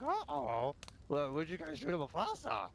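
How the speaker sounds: high, sheepish uh-oh; mock nervous Harvey. Retelling rival Harvey caught on the radio, high sheepish voice. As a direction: play the caught-out rival squeaking. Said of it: "Harvey's like".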